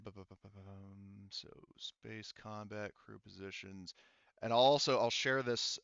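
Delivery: ordinary talk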